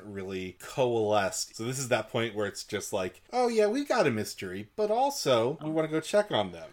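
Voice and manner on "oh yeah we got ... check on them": put-on high, louder voice for the carefree girls; lightly hammed-up, pitched-up casual quote; mock breezy. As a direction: imitate girls breezily shrugging off a mystery. Voicing the girls' casual attitude.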